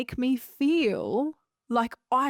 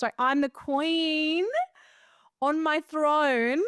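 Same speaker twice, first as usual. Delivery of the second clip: a touch of grand queen, higher and slow; mock royal queen; 6 semitones higher, much slower than usual. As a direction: play the queen on her throne, lightly. Pictures herself as carried queen.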